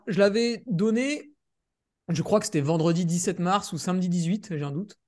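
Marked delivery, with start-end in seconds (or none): none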